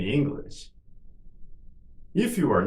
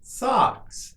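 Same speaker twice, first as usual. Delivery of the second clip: much higher, shorter take; quicker model; 13 semitones higher than usual. Shown as a demonstration. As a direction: say it quickly on a raised pitch. Teacher models word for repetition.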